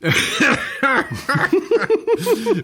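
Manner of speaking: high burst of laughter; laughing at the nickname. Real, not acted. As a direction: dissolve into laughter. Laughing at the name.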